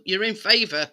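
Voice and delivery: usual speaking voice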